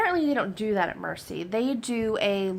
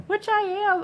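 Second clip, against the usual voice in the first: excited; 12 semitones higher, 4 dB louder than usual. Real, not acted. Finally able to confirm surgery.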